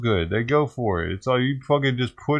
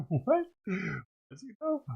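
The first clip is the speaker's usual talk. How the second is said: a laugh that jumps up high in disbelief; laugh, then a high stunned question; real laughing shock at the news clip; surprised laugh; 11 semitones higher, 9 dB quieter, much slower than usual. Real, not acted.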